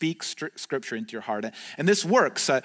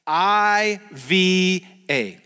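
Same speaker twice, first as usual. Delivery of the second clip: very slow, drawn-out letters for a group to join; slow chant-along spelling; leading a recitation; much slower than usual. Shown as a demonstration. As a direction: stretch each letter so everyone can say it along. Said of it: "Say 'em with me".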